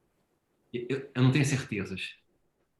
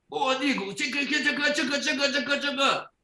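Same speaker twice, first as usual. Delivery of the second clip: much higher childish mock scolding; a little boy copying his father's telling-off; small son parroting dad's stern rebuke in a high voice; child imitating a scolding; 13 semitones higher than usual. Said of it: "falou assim com o Rodrigo".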